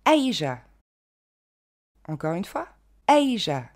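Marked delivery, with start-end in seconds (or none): 0.1-0.6 Asia en EÏ-je, première syllabe accentuée; prononciation anglaise. Shown as a demonstration. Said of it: "on n'entend pas le I ici". 3.1-3.7 Asia en EÏ-je, première syllabe accentuée; prononciation anglaise. Shown as a demonstration. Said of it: "l'accent tonique tombe sur la première syllabe".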